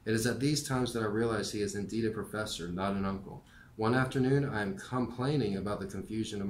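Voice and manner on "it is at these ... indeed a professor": ordinary delivery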